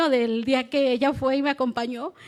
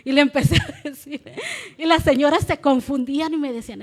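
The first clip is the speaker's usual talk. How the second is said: ordinary delivery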